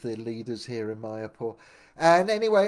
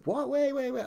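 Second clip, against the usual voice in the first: much higher, alarmed wait wait; stunned protest; 5 dB louder than usual. Retold or imitated. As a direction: cut in, thrown off balance. Shocked at being thrown out.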